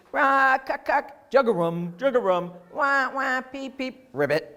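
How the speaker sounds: medley of frog calls; frog chorus. Shown as a demonstration. A teacher recapping all the frog noises. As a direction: run through every frog call. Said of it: "a lot of frog noises".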